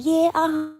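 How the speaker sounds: high, mock-proud girl voice putting on Clarissa. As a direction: brag it in a high, cocky put-on voice. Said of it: "She's like".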